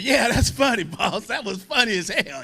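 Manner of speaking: high, laughing, careless answer; cocky laughing reply. Retold or imitated. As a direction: answer the boss high and giggling, unbothered. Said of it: "I said".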